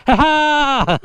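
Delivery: gleeful ha ha; triumphant laugh. Real, not acted. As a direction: crow with delight. Given Pringles, triumphant.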